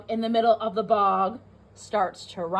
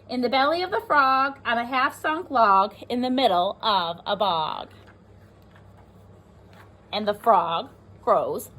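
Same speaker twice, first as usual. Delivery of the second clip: put-on lively read-aloud for young children; teacher reading a rhyming book to the class; storybook reading; 3 semitones higher, slower than usual. A narrator's reading.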